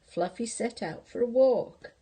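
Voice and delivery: plain reading voice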